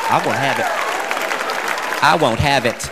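low, stern mom repeating the phrase; mom's catchphrase. Retold or imitated. Comic imitating his mother's stern catchphrase, lightly voiced. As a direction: put your foot down like his mom. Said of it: "That was her phrase".

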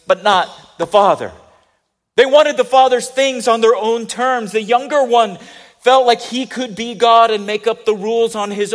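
loud, ringing sermon climax; full-force preaching. Acted. Pastor drives the point home to the congregation, preaching loudly. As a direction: preach it out to the back row.

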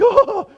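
high, loud startled "oh"; comic yelp, pitched far up; mock cry. Trainee taken aback by the nurse, mock cry. Reacting to nurse's blunt remark.

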